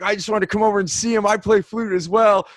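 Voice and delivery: high, bright-eyed fellow flute player; lightly voiced manager, high and keen; friendly manager voice. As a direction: play him as a delighted flute fan. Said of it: "He's like".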